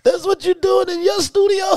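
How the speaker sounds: high, loud incredulous yelp; hammed-up high shocked voice; mock disbelief. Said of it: "I was like".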